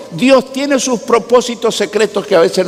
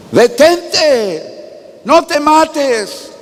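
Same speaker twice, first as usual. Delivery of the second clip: higher urgent call, stretched on no te mates; Paul's urgent cry; 6 semitones higher, slower than usual. Retold or imitated. Preacher voicing Paul calling out to the jailer, urgent and higher. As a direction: call out to stop him. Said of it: "Pero Pablo le gritó".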